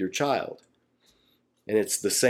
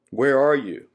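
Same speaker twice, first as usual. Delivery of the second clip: louder, higher call across the garden; 4 semitones higher, 4 dB louder than usual. Acted. God calling to hiding Adam, raised call.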